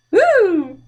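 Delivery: high, loud whoop of delight; cheerful woo. Real, not acted. Librarian cheering with the children as the monster vanishes, happy whoop.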